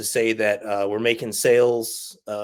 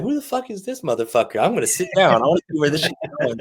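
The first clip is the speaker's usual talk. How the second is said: higher, louder and fast, caught off guard; incredulous outburst; 6 semitones higher, 5 dB louder, faster than usual. Retold or imitated. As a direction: blurt it out like you can't believe this guy. Inner reaction to stern professor.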